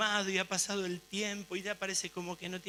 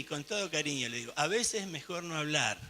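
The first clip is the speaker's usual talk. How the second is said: ordinary delivery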